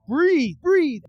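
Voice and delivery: loud, high urgent order; acted forceful shout; shouted command. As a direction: bark it at a choking man. Guard shouts while hitting choking man.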